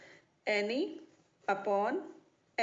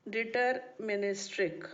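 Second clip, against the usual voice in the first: syllable-by-syllable deterministic; deterministic drawn out for note-taking; slow dictation; slower than usual. Real, not acted. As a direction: say deterministic slowly, each syllable separated. Repeats term slowly for note-taking.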